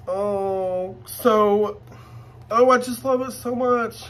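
high, gushing delight. Real, not acted. Showing viewers her new bag, high gushing delight. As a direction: swoon over it, voice lifted high.

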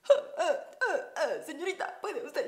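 high, hesitant mock Englishman; imitating the English. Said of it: "porque era de". (imitando a un inglés, voz aguda)

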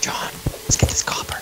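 hushed whisper. Acted. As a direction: breathe the words out unvoiced. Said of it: "Why are you whispering?".

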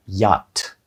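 yacht said as "yaht"; correct pronunciation. Shown as a demonstration. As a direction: leave out the ch in yacht. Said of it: "правильно вимовити це слово буде".

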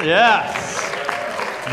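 normal talking voice

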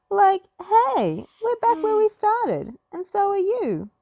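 bumped and confused; Shaggy voice. A narrator's reading. Shaggy after crashing into friends, high and loud.

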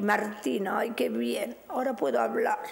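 words slip out as a breathless whisper. Real, not acted. Psychic losing her voice on air, whispering. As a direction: voice dropping to a real whisper.

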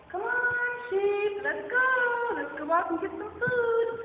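high, loud calling voice; calling sheep home across a field; shepherd calling. Acted. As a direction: call out to the flock, sing-song.

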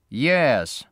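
unfriendly, forceful interjection; flat, unfriendly. A narrator's reading. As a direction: cut in coolly and firmly. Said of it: "said a flat, unfriendly voice".

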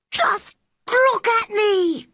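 very high, drawn-out shout; boy's defiant shout. A narrator's reading. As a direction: demand attention as a furious boy. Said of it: "he shouted at Ben".

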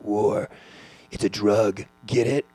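dramatic trailer narrator voice, rising; movie trailer voice. Retold or imitated. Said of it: "that was my movie trailer voice".

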